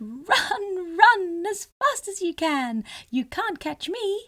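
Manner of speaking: high, loud laughing chant; laughing taunt. Acted. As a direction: laugh at them as you tease. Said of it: "he laughed and called out".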